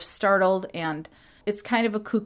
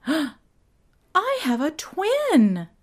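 much higher, very slow, breathless reveal; dramatic Jan; 9 semitones higher, much slower than usual. Retold or imitated. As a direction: spell out the shocking discovery word by word. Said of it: "I'm gonna be doing some impressions".

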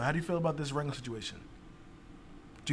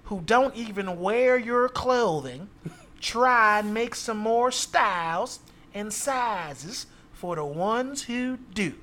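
slow, higher, loud Southern drawl; Southern accent; 5 semitones higher, 3 dB louder, slower than usual. Acted. Reading an angry customer's tweet to the others, in a Southern accent. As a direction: read it out in a drawn-out Southern accent. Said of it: "Can you do a Southern accent?".

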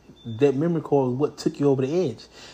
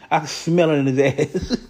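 laughing; 3 semitones higher, 4 dB louder than usual. Real, not acted. Laughing at his own joke.

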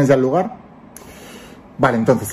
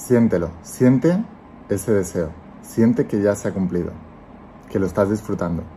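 calm guiding voice, let each phrase settle; guided meditation; 3 semitones lower, 7 dB quieter than usual. Acted. Leads a guided visualization.

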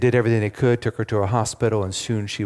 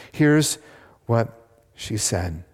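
normal talking voice

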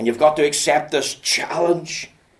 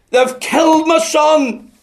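even louder and higher slow wail of grief; weeping cry, building; 9 dB louder, slower than usual. Retold or imitated. Father at the reverend's door, repeating it in a louder weeping wail. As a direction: let the grief swell and break on the repeat. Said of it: "And in his weeping".

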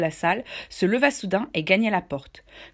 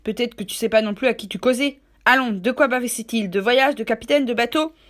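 louder, badgering sailor voice; pressing Silver; 3 semitones higher, 6 dB louder than usual. Silver forcing Morgan to confess, loud and badgering. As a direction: badger him to talk.

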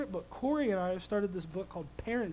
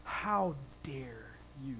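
sassy daughter; 10 semitones lower, 13 dB quieter than usual. Retold or imitated. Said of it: "She looks at me like".

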